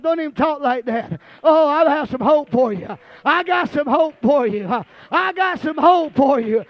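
high, loud, shouted whooping sermon; preaching at full force. Acted. Preacher voicing the father's joy to the church, shouted hard. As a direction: build the congregation up, shouting it out.

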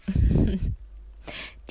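put-on breathy old-lady giggle. A narrator's reading. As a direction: chuckle like an amused old woman. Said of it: "Wieder kicherte Frau Lind".

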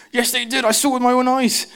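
high, squeaky mock outrage; mock angry insistence. Arguing with a doubting friend, high mock anger. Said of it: "you get really angry".

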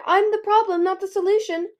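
high, loud self-blame; anguished, high-pitched protest; distressed cry. A narrator's reading. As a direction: burst out in despair. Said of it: "Peril cried".

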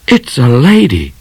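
high-pitched astonished gasp. A narrator's reading. Midnight spotting a lady rider, gasped. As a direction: blurt it out, astonished. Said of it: "gasped Midnight".

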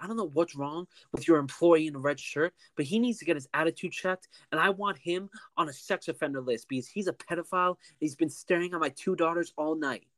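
indignant customer. Retold or imitated. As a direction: imitate an outraged customer lodging a complaint. Retold angry customer's accusation.

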